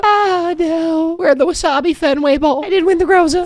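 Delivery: high, pained disappointment; dismayed cry. Real, not acted. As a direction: groan out the bad news. Misses award, minor bowl.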